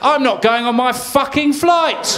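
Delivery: high, slow raging outburst; stranded traveller losing it completely; furious rant. Acted. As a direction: explode at the ruined trip. Ranting traveller in comic act.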